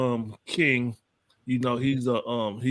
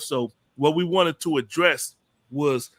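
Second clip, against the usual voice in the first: normal talking voice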